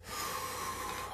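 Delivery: slow audible exhale; demonstrated calming exhale; long breath out. Shown as a demonstration. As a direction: breathe out slowly and softly. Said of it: "you keep breathing".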